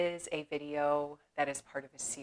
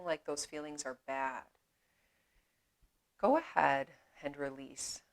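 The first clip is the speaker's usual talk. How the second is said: unhurried meditation voice with long pauses; measured, spacious yoga-teacher delivery; guided meditation. Acted. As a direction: guide them gently inward, leaving space after each phrase.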